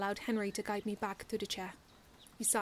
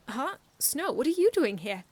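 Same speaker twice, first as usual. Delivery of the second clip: put-on surprised child; 5 semitones higher, 9 dB louder than usual. A narrator's reading. Icky surprised by Snow.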